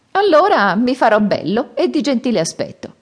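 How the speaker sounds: high, loud and sure of himself; cocky Monkey. Acted. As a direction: brush off the fear with a grin. Monkey answers after laughing. (Scimmiotto spavaldo, voce alta e sicura)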